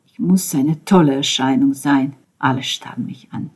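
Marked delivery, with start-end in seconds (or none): none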